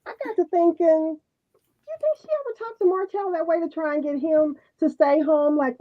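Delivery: ordinary talk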